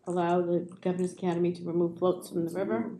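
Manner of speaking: ordinary talk, nothing marked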